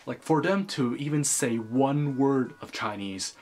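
normal talking voice

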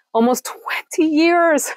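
slow, high, choked-up voice; emotional. Real, not acted. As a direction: draw it out high, overcome with emotion. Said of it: "this is really emotional for me".

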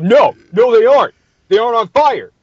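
high, loud protest, nearly screamed; shouting. Retold or imitated. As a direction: shout him down in outrage. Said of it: "Who shouts out".

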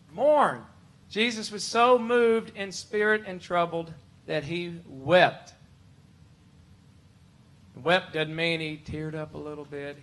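ordinary delivery

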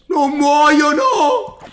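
slow, loud, laughing disbelief; drawn out in disbelief; exasperated shout. Real, not acted. Frustrated disbelief at unkillable mobs.

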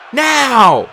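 high, loud battle signal; sudden urgent shout; shouted command. A narrator's reading. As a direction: yell the cue to charge.

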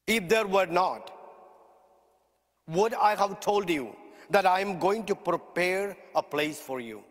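ordinary talk

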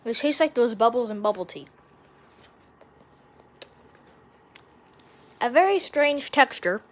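talking around chewy candy; chewing while talking. Real, not acted. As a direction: mouth partly full. Seen 0: Put dark candy in mouth before; jaw and lips chew throughout.

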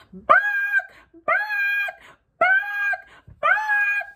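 high squawked barks; squawking chicken. A narrator's reading. Chicken squawking barks at girl tying ribbon. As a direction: shriek each bark like a chicken.

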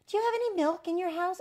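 high, loud, quick cat voice; eager cat. A narrator's reading. Cat asking the new mummy for milk, high quick voice. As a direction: ask fast and high, like a hungry cat. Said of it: "And the cat said".

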